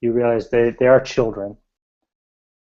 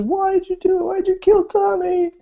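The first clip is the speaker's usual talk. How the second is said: put-on crying girl. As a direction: plead through tears as a little girl. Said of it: "The girl's crying".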